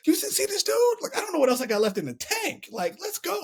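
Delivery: high-pitched, exasperated retold outburst; heated rant. Athlete vents to his coach in the warm-up area, high and exasperated. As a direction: pitch it way up like you're venting at your coach. Ranting to coach after semifinal.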